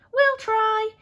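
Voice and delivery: high, loud squeaky mice; little mice squeaking a loud promise; squeaking loudly. Acted. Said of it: "they squeaked loudly".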